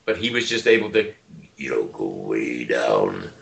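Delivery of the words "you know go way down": deep voice demo. Shown as a demonstration. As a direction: drop the voice way down low and slow. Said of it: "further than that and talk".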